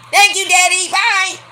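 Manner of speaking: very high, sing-out princess farewell; mock daddy's girl squealing thanks; spoiled daughter. Plays Tiffany leaving her daddy.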